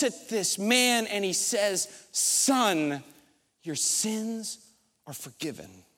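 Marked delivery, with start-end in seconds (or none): 2.1-5.8 soft and low, fading gently on forgiven; tender. Acted. Jesus speaking to the lowered man, soft and tender.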